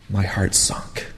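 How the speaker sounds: soft breathy whisper, heavy with grief. A narrator's reading. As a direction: let it drop to a whisper. Repeated line, second whispered.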